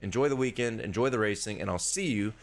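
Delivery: usual speaking voice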